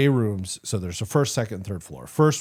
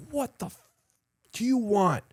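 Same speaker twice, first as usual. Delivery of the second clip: high, snapped comeback; angry retort; 13 semitones higher than usual. Retold or imitated. Rothke yelling at Nick from his office, high angry. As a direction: bark it back at him. Sergeant shouting at rival.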